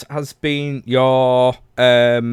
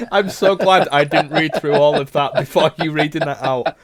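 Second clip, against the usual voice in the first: loud, higher, talking through laughter; laughing hard; 4 semitones higher, 5 dB louder than usual. Real, not acted. Host reacting to a listener's bed disaster, laughing hard. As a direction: crack up while getting the words out.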